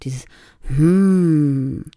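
a contented mm sound; humming hm. Shown as a demonstration. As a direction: hum on a comfortable note, mouth closed. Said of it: "diesen M-H-Laut".

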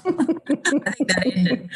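laughing. Real, not acted. Admitting to the interviewer the relationship ended, laughing.

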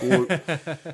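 a laughing English "Oh" like the Brighton director; British accent. Retold or imitated. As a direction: imitate a Brighton Englishman chuckling. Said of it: "he talks like this".

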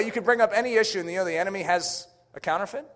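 ordinary talk

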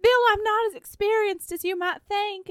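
high-pitched mock heroine voice; Suki. Suki confessing inexperience to Bill, high hammed-up voice.

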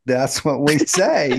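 slow, playful Southern drawl. Pretend. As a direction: stretch it out with Southern twang. Playfully claiming Southern way of talking.

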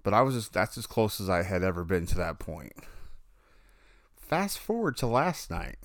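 normal talking voice

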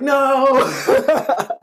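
high, laughing "No!" of disbelief; laughing mock disbelief. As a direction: burst out in amused shock. Mock shocked protest to OG.

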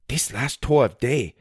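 put-on light Chinese accent, delighted; tour guide Wang. A narrator's reading.

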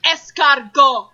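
"Escargot" drawn out slowly with a put-on French accent; fake French accent. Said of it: "throw on an accent".

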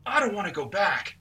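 high, put-on fearful companion; whiny companion voice. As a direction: voice a reluctant sidekick. Companion voice in gamebook.

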